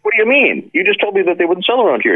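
high, disbelieving squeak; incredulous. Retold or imitated. To Tomo, stunned he wants one, high disbelief. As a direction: act floored by the request.

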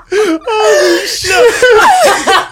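high screaming laugh; scream-laughing at the twist; shrieking laughter. Real, not acted. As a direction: lose it completely, laughing out loud. Hysterical reaction to the reveal.

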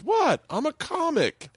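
high, slow mock-innocent protest; imitating an indignant comic; defensive protest. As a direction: plead your case way up high. Comic defends his joke, retold.

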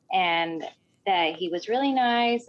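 ordinary talk

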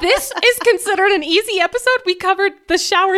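high, loud ironic laughing; sarcastic laugh. Real, not acted. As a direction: scoff it out with a laugh.

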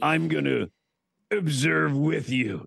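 imitated sportscaster, stretched words; slow, mock-weighty announcement; Chris Berman. Sportscaster offering to stay along, imitated and drawn out. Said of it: "how loud he sounded".

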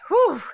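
a loud, high whoosh of breath to psych up; big exhale. Real, not acted. Host psyching up before the timed segment, loud whoosh of breath. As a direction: blow out hard before the countdown. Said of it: "All right, deep breath".

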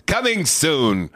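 put-on slow, high old-timey announcer; vintage radio announcer, drawn out; 1940s radio voice. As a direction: tease it like a vintage radio promo.

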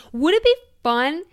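eager rising question; excited idea. Real, not acted. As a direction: get suddenly thrilled by the idea. Excited idea of live podcast meetup.

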